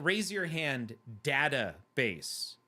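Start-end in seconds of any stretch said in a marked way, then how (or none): 1.2-2.5 "database" said slowly as dat-uh-base; flat-as-in-cat first A in "database"; short-A database. Shown as a demonstration. Streamer polling viewers on pronunciation, database with short A. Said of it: "who pronounces it".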